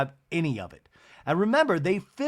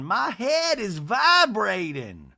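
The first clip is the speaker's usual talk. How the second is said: high, drawn-out mock wail of complaint; slow, high-pitched imitation of an indignant woman; Miss Patricia; 6 semitones higher, 3 dB louder, much slower than usual. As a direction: stretch it out high like a hammed-up complaint. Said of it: "and Miss Patricia going".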